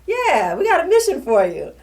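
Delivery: high, loud imitation of unit superiors; imitated officials cheerfully handing down orders; breezy bearer of news. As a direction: call it out in a mock-cheery singsong. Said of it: "and they said".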